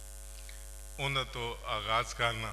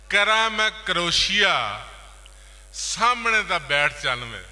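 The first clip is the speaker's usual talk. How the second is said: louder, higher, ringing verse delivery; poetry recitation; 3 semitones higher, 9 dB louder than usual. Acted. Poet reciting another boli to listeners, declaimed. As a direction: project each phrase and let the last one fall.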